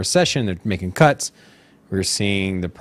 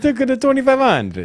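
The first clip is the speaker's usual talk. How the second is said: high mock voice; 3 dB louder than usual. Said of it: "He said".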